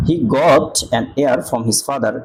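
slow demo reading. Shown as a demonstration. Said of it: "air উচ্চারণ হয়েছে".

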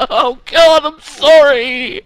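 ordinary talk

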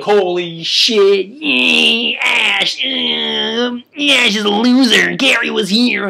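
squeaky high brat bragging; bratty Gary voice. Acted. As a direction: play the smug kid rival taunting. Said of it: "Gary will be like".

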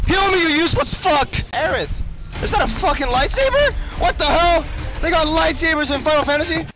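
high, loud yelling; frantic yell. Real, not acted. Streamer screaming at the healer in a fight.

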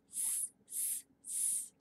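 long unvoiced S hiss; snake hiss sound. Shown as a demonstration. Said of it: "Do you hear the sound S makes?".

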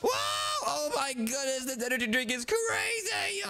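high squeal, stretched at the end; hyped-up excitement. Pretend. Gamer just after trying an energy drink, hyped-up squeal.

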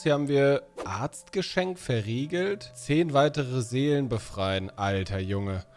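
ordinary talk

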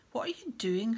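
loud, accusing question; suspicious snarl. A narrator's reading. Caretaker catching a boy alone, suspicious snarl. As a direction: pounce on him with suspicion. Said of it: "Filch snarled suspiciously".

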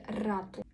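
РАТУ with a rolled first R; old-style trilled R. Shown as a demonstration. Teacher quotes northern old people, trilled РАТУ. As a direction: roll the R opening РАТУ. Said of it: "старих людей будуть говорити".

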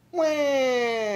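put-on high, loud baby wail; baby ghost yell. A narrator's reading. Baby ghost yells at the noise, wailing. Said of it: "yelled Baby Ghost".

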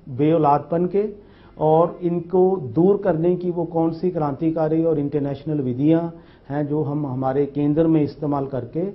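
ordinary talk, nothing marked

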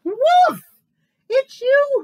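very high, loud astonished cry; big high hoot of surprise; hooting owl exclamation. A narrator's reading. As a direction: shout it in shocked discovery. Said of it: "hooted Owl".

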